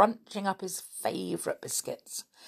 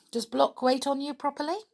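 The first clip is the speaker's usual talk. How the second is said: a hint of Lenoir; 9 semitones higher than usual. Lenoir voiced in the story.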